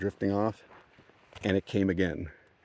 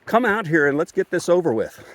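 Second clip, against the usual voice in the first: raised, higher-pitched challenge, re-enacted; loud mock dare; retold shouting; 8 semitones higher, 5 dB louder than usual. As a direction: act out yelling at an unseen intruder. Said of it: "this time I started shouting".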